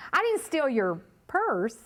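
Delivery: slow lead-in, "purse" held back and lifted at the end; pause, then land on "purse"; stress on PURSE. Shown as a demonstration. Trainer showing how emphasis changes meaning, stress on "purse". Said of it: "where I put the emphasis".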